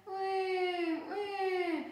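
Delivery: put-on high, loud child's plane sound; aeroplane noise. A narrator's reading. Baby Bear playing aeroplane in bed, high plane noise. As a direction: zoom like a toy aeroplane. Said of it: "went Baby Bear".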